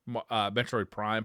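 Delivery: usual speaking voice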